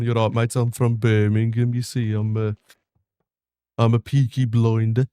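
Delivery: put-on lips barely moving, Birmingham accent; Brummie accent. Streamer showing off his Birmingham accent to chat. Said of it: "the Brummie one".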